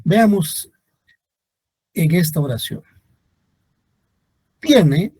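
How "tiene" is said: "Tiene" with TIE punched loud and high; stress on first syllable. Shown as a demonstration. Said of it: "la sílaba tónica es tiene".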